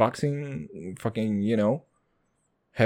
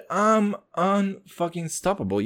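ordinary delivery

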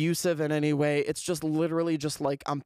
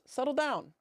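real high-pitched telling-off; scolding a pet; 9 semitones higher than usual. Real, not acted. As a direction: call the bunny to order, pitched up. Calls out to the pet bunny.

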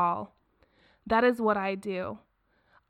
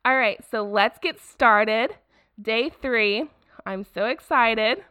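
ordinary talk, nothing marked

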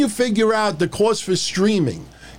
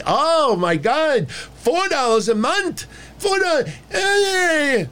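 mock incredulous; 9 semitones higher, slower than usual. Real, not acted. Mocking price complaints.

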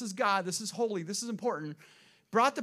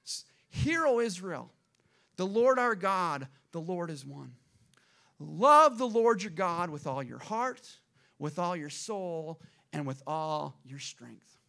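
slow, weighty scripture proclamation; solemn public recitation, opening strong; Moses proclaiming scripture; 4 dB louder, slower than usual. Acted. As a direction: declare it line by line, drawn out, easing down at the end. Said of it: "This is what Moses said".

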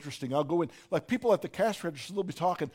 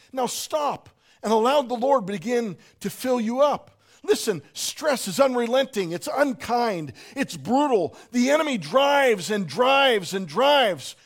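preaching at full force, louder and higher; 6 semitones higher, 8 dB louder than usual. Acted. Preacher urging the congregation, preaching hard. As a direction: drive it home from the pulpit.

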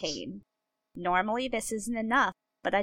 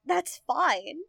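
mock consoling; 6 semitones higher, 5 dB louder, much slower than usual. Mock consoling the crying son.

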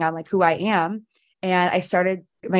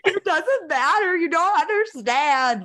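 much higher, tearful and laughing through it; slow, choked-up protest half laughing; retold crying; 11 semitones higher, slower than usual. Answering her husband while crying over the million, high tearful voice. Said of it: "I was, like, bawling my eyes out".

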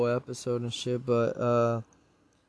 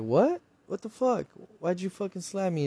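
high-pitched, indignant disbelief; shocked protest; 7 semitones higher than usual. Retold or imitated. Slapped player demanding why he was hit, shocked protest. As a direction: retell his stunned outburst in a raised voice. Acted reaction to being slapped.

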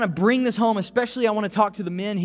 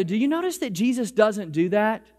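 ordinary delivery

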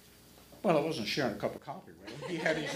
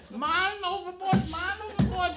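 man overboard shout; 4 dB louder than usual. Retold or imitated. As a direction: call it out over the siren. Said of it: "and he goes".

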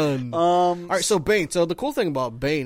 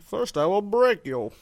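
slow, higher villain threat; Bane impression; 7 semitones higher, slower than usual. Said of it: "That's a Bane".

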